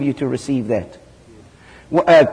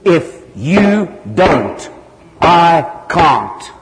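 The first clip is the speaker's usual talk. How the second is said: very slow, stern rebuke; angry rebuke; 3 semitones higher, much slower than usual. Retold or imitated. Jesus rebukes Hagin for his mistake in a slow, stern rebuke. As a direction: lay down the law word by word. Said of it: "Jesus got very angry with him".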